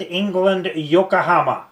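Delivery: ordinary delivery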